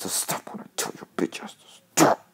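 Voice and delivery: whispered, annoyed aside; exasperated whisper to the horse; whispered scolding. Real, not acted. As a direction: hiss it at the horse. Scolding horse under breath.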